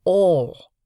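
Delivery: "All" punched hard and loud; emphatic. A narrator's reading. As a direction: stress "All" with full weight. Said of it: "very emphatically".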